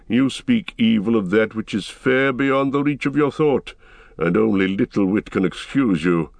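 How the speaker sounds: lightly voiced dwarf's stern warning; indignant dwarf. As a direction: throw his title back at him. Gimli throws the title back.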